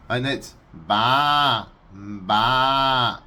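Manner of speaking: slow, loud baa bleat; sheep bleat impression. Shown as a demonstration.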